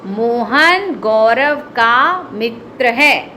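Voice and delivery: slow model read of the sentence; slow, careful classroom read-aloud; slow demo reading. Shown as a demonstration. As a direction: read it slowly for the class to follow. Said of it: "मैं पढ़ के बताती हूँ".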